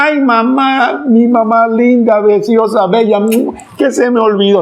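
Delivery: put-on high, slow wheedling child voice; coaxing son. Son flattering his mother to dodge blame, high and syrupy. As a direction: sweet-talk mom to get out of the chore.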